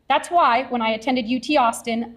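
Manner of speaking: usual speaking voice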